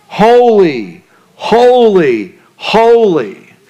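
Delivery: each holy drawn out and falling; holy held long, high, each one falling; solemn triple holy. Acted. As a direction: ring out holy three times like a chant. Threefold emphasis on holy.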